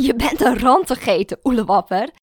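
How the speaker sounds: loud mocking laugh through the words; laughing teasing. A narrator's reading. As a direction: tease her while laughing. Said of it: "lacht Mara me uit". (lachend plagend)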